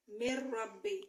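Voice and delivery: मिर रब्बी with the n turned into a doubled r; merged mirrabbi. Shown as a demonstration. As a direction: say मिर रब्बी as one word, long rr. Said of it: "मिन रब्बी पढ़ने के बजाय मिर रब्बी पढ़ा जाएगा".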